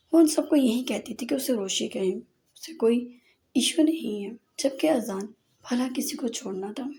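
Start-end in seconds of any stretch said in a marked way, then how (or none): none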